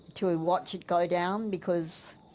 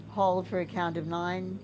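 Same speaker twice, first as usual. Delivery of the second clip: soft spa whisper; 4 dB quieter than usual. Shown as a demonstration. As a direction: count the breaths gently and evenly. Leads a breathing exercise.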